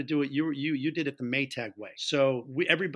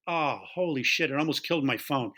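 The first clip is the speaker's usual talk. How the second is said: ordinary delivery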